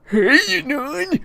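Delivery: very high squealed greeting; loud, squeaky character voice saying hello; Eric. Acted. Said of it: "says in a perfectly normal voice".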